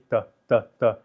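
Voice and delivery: evenly spaced, separate identical beats; robotic, rhythmic staccato demo. Shown as a demonstration. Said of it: "speak with a very staccato".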